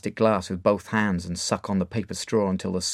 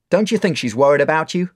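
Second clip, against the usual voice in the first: loud, higher probing; bar owner; 7 semitones higher, 8 dB louder than usual. Acted. Mark questioning Dave about his mother: probing. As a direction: challenge him. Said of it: "he asks".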